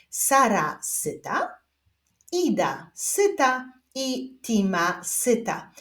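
slow word-by-word reading; measured beginner's reading with spaced words; reading demonstration. Shown as a demonstration. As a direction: read aloud slowly for learners to follow. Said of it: "zapraszam Was do odczytywania zdań".

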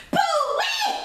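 very high, loud whoop at scalding porridge; hot porridge yelp. Acted. Goldilocks tastes hot porridge.

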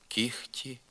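кіхті with a soft х in place of г. Shown as a demonstration. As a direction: say нігті-style кіхті with х. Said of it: "заступається звуком Х". (г оглушується в х: кіхті)

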